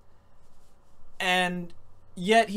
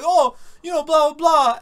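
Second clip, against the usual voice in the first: mock David; 7 semitones higher, 8 dB louder than usual.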